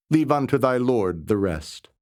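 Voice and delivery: plain reading voice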